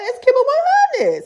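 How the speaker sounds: very high, laughing God voice; laughing mock God; squealing laugh.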